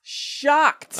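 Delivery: exaggerated shock. Co-host playing up shock at a casting choice, exaggerated. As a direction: play up the shock, stretching the word.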